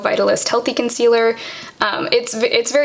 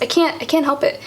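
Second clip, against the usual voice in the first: normal talking voice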